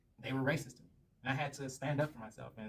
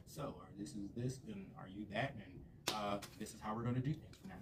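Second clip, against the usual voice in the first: low, soft, tedious interviewer voice; mock stiff interviewer; 4 semitones lower, 8 dB quieter than usual. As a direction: drone it quietly, parodying a dull interview. Acts out a stiff interview-like date.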